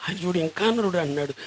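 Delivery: ordinary delivery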